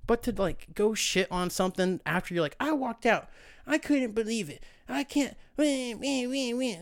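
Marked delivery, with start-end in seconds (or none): 2.6-6.8 high, whiny crybaby voice with wah wah; mock whining. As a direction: whine like a crybaby. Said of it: "everybody just whining".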